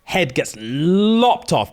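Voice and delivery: normal talking voice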